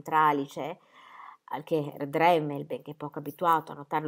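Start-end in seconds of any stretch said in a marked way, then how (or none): none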